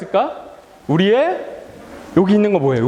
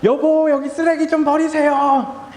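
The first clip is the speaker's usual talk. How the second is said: high, drawn-out wifely nagging; imitated wife; 7 semitones higher than usual. Pastor imitating his wife asking him for help, high and drawn-out. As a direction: imitate a wife calling out a chore. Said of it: "목사님 아내가".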